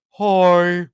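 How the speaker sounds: light imitation of the Elf narwhal; a hint of a cheery cartoon narwhal. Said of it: "sounding like the narwhal from Elf".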